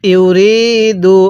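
ইউরিদু intoned very slowly with a long held vowel; teacher demonstrating ইউরিদু in recitation style; slow tajweed recitation. Shown as a demonstration. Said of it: "কিভাবে পড়তে হবে?".

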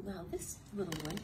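ordinary delivery

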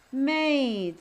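Made with a drawn-out ay; long vowel sound. Shown as a demonstration. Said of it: "also that is its sound".

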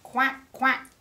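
high, loud quacks; duck's quack. A narrator's reading. Storyteller voices a duck for children, loud quacks. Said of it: "called the duck".

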